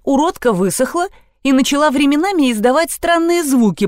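ordinary delivery, nothing marked

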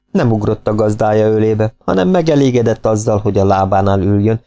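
usual speaking voice

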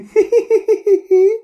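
very high, staccato giggling cackle; high spirit cackle. Acted. High-toned spirit laughing at her scheme, eerie high cackle. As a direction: cackle in a thin, high pitch. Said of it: "Đó là cười như vậy".

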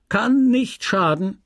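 high, slow, drawn-out comeback; scornful retort. A narrator's reading. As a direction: answer back smugly. Merchant answers her protest.

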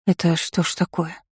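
soft, lost question; bewildered. A narrator's reading. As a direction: ask it quietly, at a loss. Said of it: "растерянно спросила ведьмочка".

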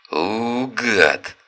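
snarled insult; angry growl. Acted. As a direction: hostile outburst. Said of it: "угрожающий расправой голос".

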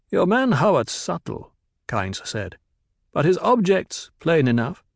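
formal and guarded, quick; Kynes, stiff planetologist. A narrator's reading. Kynes voiced by narrator.

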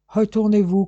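Englishman's forceful command. A narrator's reading. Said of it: "commanda l'Anglais".

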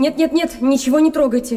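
a rush of alarm, stop them now; alarmed plea. Acted. Woman begs the captain not to touch the rubble, alarmed.

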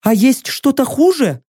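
high, firm warrior woman's voice; a proud captive bargaining; proud female warrior. Acted.

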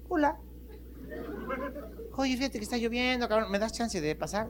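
pleading monkey. Acted. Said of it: "el pájaro dijo".

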